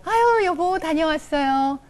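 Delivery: high, slow, sugary hello to her husband; sweet wife greeting. Acted. Wife sweetly welcoming her husband home, high and slow. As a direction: coo the greeting lovingly. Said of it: "제가 집에서 이렇게 하면".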